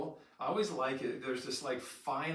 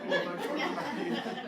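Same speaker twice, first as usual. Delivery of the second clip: whispered, slow, laughing exclamation; breathless laugh; 10 semitones higher, 4 dB louder, much slower than usual. Retold or imitated. Recalling life with one child.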